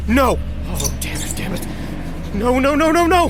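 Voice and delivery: high, loud panicked cries. Acted. Agent watching the creature escape, panicked cries. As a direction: panic as it gets away.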